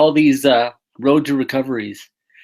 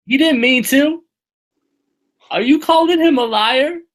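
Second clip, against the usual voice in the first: squeaky, loud, hammed-up indignation; high, loud mock offense at the co-host's doubt; hammed-up offended friend, much higher and louder; mock outrage; 13 semitones higher, 5 dB louder than usual. Mock-outraged echo of co-host.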